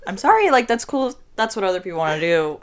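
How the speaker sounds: normal talking voice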